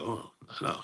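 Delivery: breathless, hushed dismay under the breath; whispered oh no. Retold or imitated. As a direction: whisper it like a small disappointment. Reacting to Pepsi at counter.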